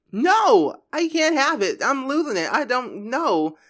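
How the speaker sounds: high-pitched, overdramatic protest; acted meltdown. [name] losing it in the argument, hammed-up meltdown.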